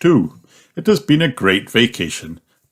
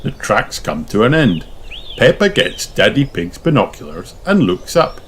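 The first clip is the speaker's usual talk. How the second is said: ordinary delivery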